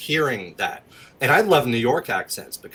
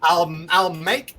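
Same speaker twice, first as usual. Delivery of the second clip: playful Southern drawl, pitch jumping high; Southern accent; 6 semitones higher, slower than usual. Shown as a demonstration. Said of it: "I can also do a Southern accent".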